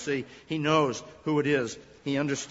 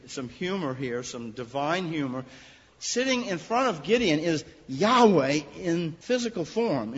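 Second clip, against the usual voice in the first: ordinary talk, nothing marked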